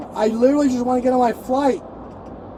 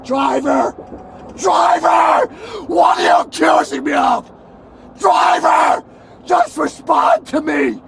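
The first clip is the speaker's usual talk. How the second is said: shouting; 3 semitones higher than usual. Real, not acted. Arrested passenger shouting at the silent police driver, angry. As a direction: keep calling out until he answers.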